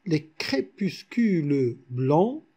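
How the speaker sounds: slow "crépuscules" with "-le" voiced before "blancs"; slow verse scansion, sounding the E of "crépuscules"; counted final E. Shown as a demonstration. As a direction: say "crépuscules" as cré-pus-cu-leu. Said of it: "on va dire".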